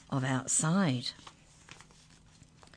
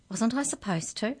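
higher, feigned-innocent voice; mock innocence, pitched up; innocent question; 7 semitones higher than usual. A narrator's reading. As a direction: ask it as if blameless. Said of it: "asked the mouse innocently".

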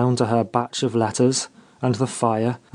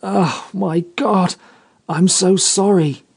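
burst out; 6 semitones higher than usual. A narrator's reading. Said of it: "he burst out with".

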